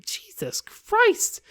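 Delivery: drawn-out horrified gasp of words; shocked disbelief. Real, not acted. Reacting to disturbing line.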